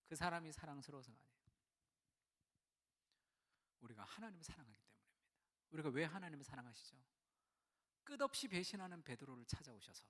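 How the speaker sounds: usual speaking voice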